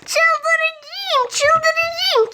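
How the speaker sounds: high, theatrical "çıldıracağım" lament; film-style despair. Acted. Fussing over her hair, hammed-up movie despair. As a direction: wail like a movie heroine losing it. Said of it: "Kesinlikle bir filmden almadım".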